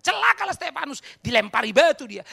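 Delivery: very loud, high ironic pronouncement; sarcastic outrage at full voice; mock condemnation. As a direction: hurl a mock curse.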